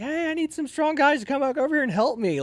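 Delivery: high-pitched acted-out call; retold plea across a field; calling for help. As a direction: holler for backup without much volume.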